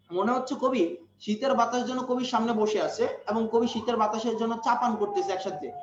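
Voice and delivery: usual speaking voice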